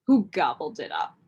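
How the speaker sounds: usual speaking voice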